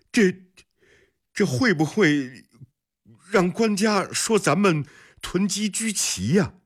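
high-pitched, halting worried query; hesitant servant. A narrator's reading. Servant warning master about hoarding charges, hesitant and high. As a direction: raise a timid doubt to the boss.

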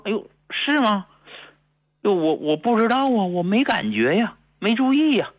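mock-surprised older woman, pitched high; surprised wife. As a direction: imitate the wife caught off guard, voice jumping up. Wife surprised by son's remark.

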